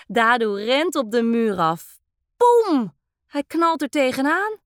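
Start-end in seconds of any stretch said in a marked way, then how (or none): 2.4-3.0 loud, high bump noise; a sudden comic crash; bang sound. A narrator's reading. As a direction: narrator voicing the boy hitting the wall, loud. Boy crashes into the wall.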